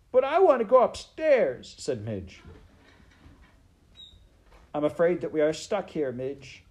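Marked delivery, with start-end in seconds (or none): 0.1-1.7 loud, very high protest; wailing serving girl. Acted. Maid begging to go upstairs, high loud wail. 4.7-6.6 quick, raised, higher voice; shouted. A narrator's reading. Princess explaining to the maid, quick shout. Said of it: "shouted the princess".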